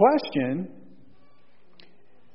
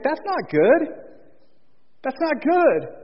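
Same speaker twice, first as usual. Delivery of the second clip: high-pitched, slow mock concern; lightly acted, drawn-out high disapproval; God disapproving; 8 semitones higher, slower than usual. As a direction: spot the problem and say it slowly, pitched up. Voices God seeing Adam alone.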